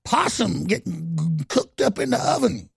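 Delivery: put-on a touch of an old country granny; mock granny. Host mocking a put-on folksy granny voice. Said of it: "Talking like Granny".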